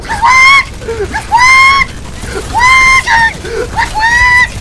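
hamster squeaks. Pretend.

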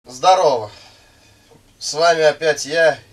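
usual speaking voice

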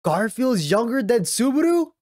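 high, loud shocked fourteen; shouted astonishment at the age; disbelief. Real, not acted.

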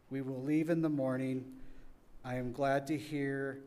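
normal talking voice

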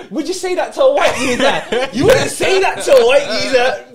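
loud, high retold challenge, laughter in it; indignant comeback. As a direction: challenge him loudly, half laughing in disbelief.

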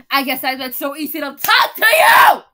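loud, nervous outburst; shouting from nerves. Acted. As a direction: blurt it out too loud from nerves. Nervous guy blurts too loudly.

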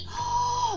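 quick high breath in; delighted gasp. Real, not acted. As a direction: gasp at an invitation. Invited out in game.

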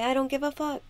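shouted high and loud on the in-breath; big, exuberant shout of freedom; loud defiant declaration. Shown as a demonstration. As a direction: belt it out like a triumphant release. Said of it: "breathe in and say".

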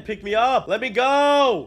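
rising, loud drawn-out yell; protesting shout. Real, not acted. Player carried off by a stranger, protesting shout.

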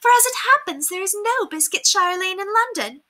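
high put-on aristocrat voice; excited lady voice. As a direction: play the vain lady, pleased. Lady Constance explains delay.

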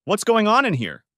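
loud, high, demanding question; man calling out. A narrator's reading. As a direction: call into an argument from the doorway. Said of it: "A man's voice comes from the front door".

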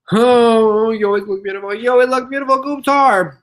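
high, effusive compliment; gushing praise. Real, not acted. Complimenting a viewer on livestream, gushing.

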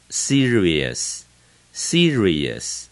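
serious slowly, strong SE; front stress. Shown as a demonstration. Teacher drilling new words, serious stressed on SE. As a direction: hit the first syllable of serious. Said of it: "重音在前面一个音节".

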